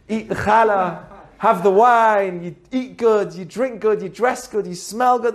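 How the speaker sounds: ordinary talk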